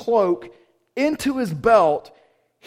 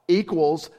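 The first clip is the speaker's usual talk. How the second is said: normal talking voice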